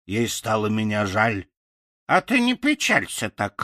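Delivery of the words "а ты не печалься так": husky crow. Acted. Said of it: "хрипло сказала она мне".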